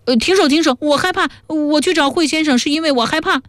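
very high, frightened squeal at modest volume; acted squealing. A narrator's reading. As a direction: squeal in panic like a tortured captive, held back in volume. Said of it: "他杀猪般的尖叫起来".

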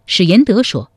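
usual speaking voice

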